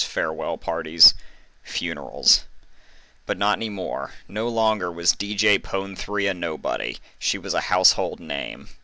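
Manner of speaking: usual speaking voice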